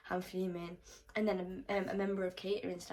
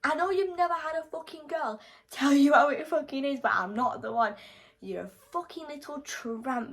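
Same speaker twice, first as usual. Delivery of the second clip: shouting high and loud at him; re-enacting a furious telling-off; furious rant; 4 semitones higher, 4 dB louder than usual. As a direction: tear into him, raging. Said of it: "I was going mental".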